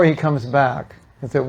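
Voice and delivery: ordinary talk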